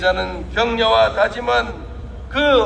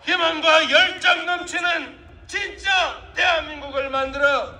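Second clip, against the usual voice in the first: building rally cry to the crowd; rousing shout; 6 semitones higher than usual. Real, not acted. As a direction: call out to the crowd, full force.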